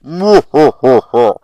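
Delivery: low loud villain chuckle; deep evil laugh. Acted. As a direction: laugh like a boss monster. Grandmaster's laugh.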